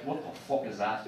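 low, quiet gruff dad; a low, muttered jab like a right-off-the-boat father; lightly voiced Irish father, low and quiet; mock Irish dad.

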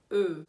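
"E" loud and low through rounded lips; pouted French eu. Shown as a demonstration. Teacher demonstrates the French sound, a pouted "E". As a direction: pout and say "E", as French eu. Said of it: "vai sair esse som".

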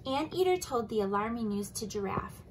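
ordinary delivery

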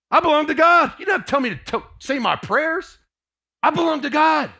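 high, loud declaration; exultant cry of belonging; joyful proclamation. Real, not acted. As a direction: shout it like a triumph.

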